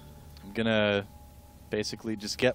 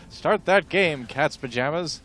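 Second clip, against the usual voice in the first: louder, higher fifties slang; 5 semitones higher, 3 dB louder, slower than usual. Shown as a demonstration. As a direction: punch it like a fifties hipster. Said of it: "That's my nineteen fifties voice".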